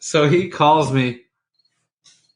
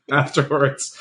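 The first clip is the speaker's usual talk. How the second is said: normal talking voice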